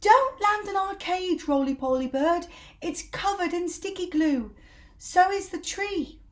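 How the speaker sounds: high, loud frantic warning; shouting. Acted. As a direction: shriek at the bird to stay away. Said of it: "they shouted".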